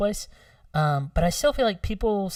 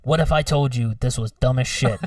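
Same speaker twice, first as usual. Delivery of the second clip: normal talking voice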